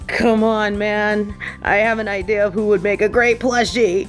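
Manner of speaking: ordinary talk, nothing marked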